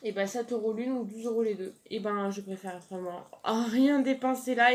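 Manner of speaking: ordinary talk, nothing marked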